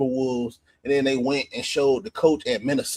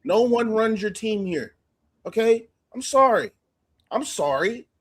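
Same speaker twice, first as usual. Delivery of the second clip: high, hammed-up obedient apology; mock submission; 7 semitones higher than usual. Pretending to yield to the coach, mock-submissive. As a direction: give in with exaggerated meekness.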